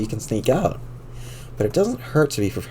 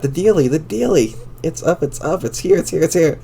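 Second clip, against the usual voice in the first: excited; 5 semitones higher than usual. Acted. Mailbox flag excitement.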